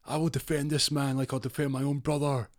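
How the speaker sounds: McNulty vowing to the press, high and grand; defiant defender. Retold or imitated. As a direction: swear it like a soap hero. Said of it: "He goes".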